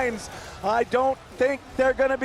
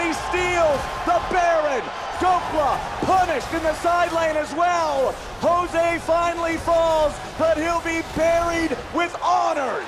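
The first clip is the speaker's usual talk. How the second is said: excited shout; 6 semitones higher than usual. Real, not acted. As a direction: yell it out as the steal lands. Caster hype over Baron steal.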